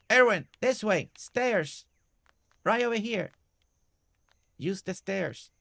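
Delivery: high, projected call, easing off at the end. Pretend. Player guiding Erwin to the stairs, calling out. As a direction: call directions across a distance. Calling directions to game character.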